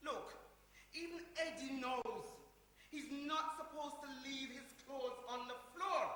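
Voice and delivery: ordinary delivery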